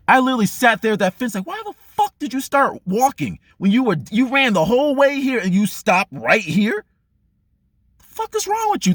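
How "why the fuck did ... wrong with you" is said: mock scolding himself, climbing high at the end; self-scolding rant. Man at the fence chewing himself out, mock angry. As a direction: berate yourself for walking. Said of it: "This is how I talk to myself".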